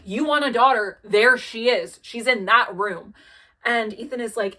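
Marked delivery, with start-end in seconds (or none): 0.1-3.1 angry retort. Retold or imitated. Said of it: "They are pissed off".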